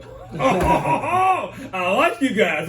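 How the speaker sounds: drawn-out high, loud "oh"s breaking into laughter; laughing relief. Retold or imitated. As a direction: crack up loudly, pitch way up. Retold reaction to cop's joke.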